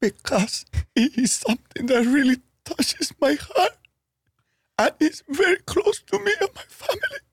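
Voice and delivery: high, wavering fake sob voice; mock tearful. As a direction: ham up crying over the family recipe. Boss fake-crying over recipe.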